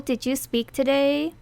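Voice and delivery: ordinary delivery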